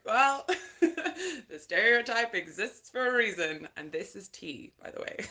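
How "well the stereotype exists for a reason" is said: laughing shrug. Retold or imitated. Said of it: "I was just like".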